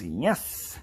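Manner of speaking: put-on yes swooping upward; lightly voiced game character's odd yes; hunched game guy. Hunched-over man answers whoever talks to him, rising put-on yes. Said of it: "he goes".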